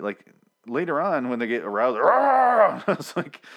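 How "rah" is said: loud, high imitated zombie roar. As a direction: let out a sudden mock monster roar.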